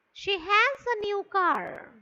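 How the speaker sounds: slow statement, high start dropping on "car"; falling intonation. Shown as a demonstration. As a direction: let "car" fall right down. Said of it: "it is falling".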